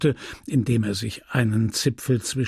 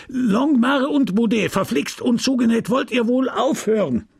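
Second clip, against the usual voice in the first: high-pitched, raised scolding voice; scolding call; 11 semitones higher, 3 dB louder than usual. A narrator's reading. Priest breaking off to yell at unruly children, high scolding call. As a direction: call the rowdy boys to order, pitched high.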